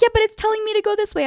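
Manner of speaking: high, rushed mock complaint; put-on hurried whine; protesting driver.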